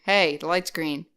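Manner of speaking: ordinary talk, nothing marked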